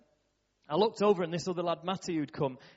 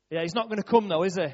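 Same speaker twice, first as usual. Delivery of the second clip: loud, higher blurt in a silent tent; really loud; 3 semitones higher, 8 dB louder, faster than usual. Retold or imitated. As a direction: blurt it out loudly without meaning to. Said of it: "Ricky says to me really loud".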